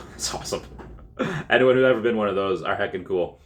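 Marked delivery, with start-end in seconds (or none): 0.1-0.6 soft, breathless whisper; whispered awe. Real, not acted. Narrator whispering in awe at toy showrooms.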